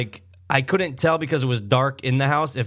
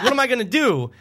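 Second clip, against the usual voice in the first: higher-pitched retold exasperation; helpless protest; 9 semitones higher than usual. Dad stranded by the car, helpless protest. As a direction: throw up a mock-helpless question.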